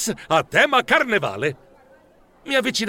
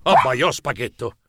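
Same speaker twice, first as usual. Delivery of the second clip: plain reading voice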